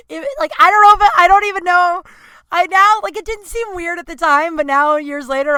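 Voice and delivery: high, loud, fast and flustered; real flustered burst; flustered excited. Real, not acted. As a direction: blurt it out, embarrassed. Flustered over make-out confession.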